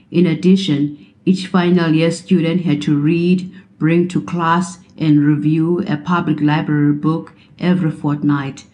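plain reading voice

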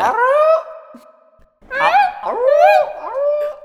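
high creature howl; Varactyl call. Pretend. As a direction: howl like a wolf-like beast. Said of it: "You should keep the Varactyl impression in".

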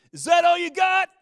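loud, high call from the back; shouted challenge. Retold or imitated. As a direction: yell it across the room as a dare. Voice calls from back of room.

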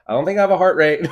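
high, loud and fast shouting; mock shout. Retelling the racing heart, a loud high shout. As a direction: shout it out in a panic. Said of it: "just, like, shouting out".

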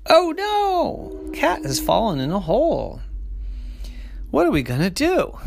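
high, loud alarmed cry. Acted. Sisters finding their cat in a hole, alarmed. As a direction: sound panicked for the cat.

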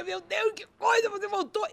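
very high, awestruck exclamation; overflowing love. Retold or imitated. Telling God she sees his return, high loving awe. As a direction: squeeze it out high, full of love. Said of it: "eu me exprimia de amor pra dizer pra ele".

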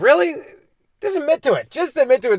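high, incredulous disbelief; can't believe this guy, say it up high; exasperated pleading to just admit it. Real, not acted. Exasperated at litterer's denial.

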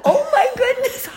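high, loud laughing exclamation of dismay; retold horror. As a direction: recount your horror, amused and high-pitched. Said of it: "I was horrified that".

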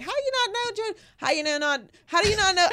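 put-on a failed Boston accent, pitched high; bad Boston accent, needling; attempted Boston accent. Mocking Patriots fans who scold him, bad Boston accent. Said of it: "I can't get the Boston accent".

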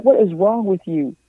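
mock-exasperated best friend; imitating Mike. Mike reacting to her stunt, lightly mock exasperated.

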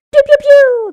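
high, loud zapping pews; sound effect. Pretend. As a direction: shoot off cartoon laser sounds. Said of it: "that should come with a sound effect".

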